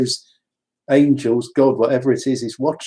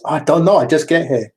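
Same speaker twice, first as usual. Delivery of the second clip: higher, louder mock-Japanese delivery; put-on Japanese accent; 4 semitones higher, 4 dB louder than usual. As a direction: voice the midwife in a playful Japanese accent.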